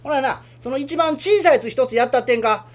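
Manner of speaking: ordinary delivery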